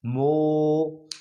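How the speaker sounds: the loud, held syllable of a call; stretched call syllable. Shown as a demonstration. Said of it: "तेज आवाज कहाँ से आ रही है".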